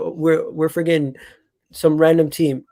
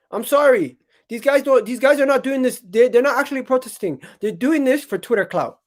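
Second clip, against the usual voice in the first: exasperated rant; 9 semitones higher than usual. Real, not acted. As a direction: scold the protesters like they're ridiculous. Ranting at protesting fans.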